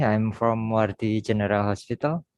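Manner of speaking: ordinary talk, nothing marked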